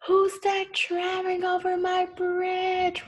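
high, drawn-out storybook roar; roaring troll. A narrator's reading. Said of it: "roared the troll".

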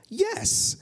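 high-pitched, eager witch's yes; eager cry. A narrator's reading. Witch welcoming the bird aboard, high eager cry. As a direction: call out a keen yes. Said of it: "cried the witch".

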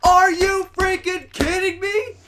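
high-pitched screaming tirade; high, strained furious rant; gamer rage. Real, not acted. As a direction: lose it at the game, words drawn out. Comic rage at the game.

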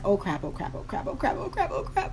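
soft, fast panicked whisper; under your breath, rising panic; panicked muttering. Retold or imitated. Hunting for her ID, a panicked whisper.

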